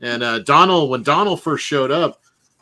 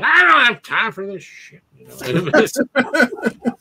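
higher, louder mock outburst; hammed-up furious customer; mock angry caller; 11 semitones higher, 5 dB louder, slower than usual. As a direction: huff it out like a fed-up drunk. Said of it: "some drunk guy going".

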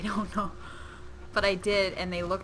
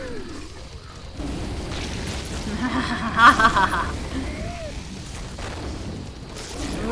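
long rapid cackle; laughter swelling as the fight goes on; gleeful laugh; 5 semitones higher, 4 dB louder than usual. Real, not acted. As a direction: laugh away while blasting enemies. Laughing during game carnage.

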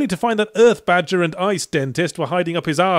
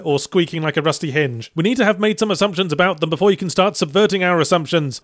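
ordinary talk, nothing marked

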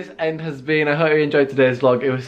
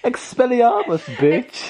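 mock spell cast; 10 semitones higher, slower than usual. Casts spell jokingly.

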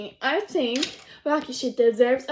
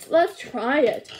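ordinary talk

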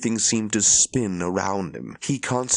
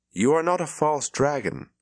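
firm; 7 semitones higher than usual. Acted. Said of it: "the Amyrlin said firmly".

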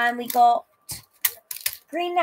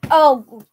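loud pained oh; 12 dB louder than usual. Real, not acted. Sour candy hits.

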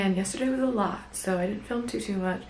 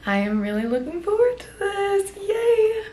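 high, bright excited build; rising into a happy cheer; 12 semitones higher, 4 dB louder than usual. Real, not acted. Excited before leaving.

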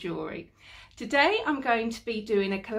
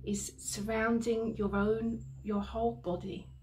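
guided meditation. Acted. Healer guiding a listener through violet flame cleansing, guided meditation. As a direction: lead them calmly through the imagery, unhurried.

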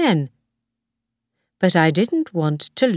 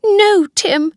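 high, loud cry of alarm; shout; 6 dB louder than usual. A narrator's reading. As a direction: shout to stop the child. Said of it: "Missus Harvey shouted".

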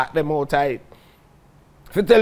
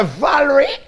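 surprised recognition; 8 semitones higher, 5 dB louder than usual. Retold or imitated. Recognizing the woman with the knife.

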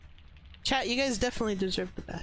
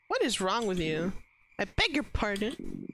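normal talking voice

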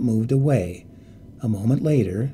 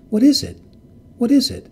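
high, loud frightened crying out; terrified cry; 7 semitones higher, 4 dB louder than usual. A narrator's reading. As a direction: cry out in terror, pitch rising. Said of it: "she cried again and again".

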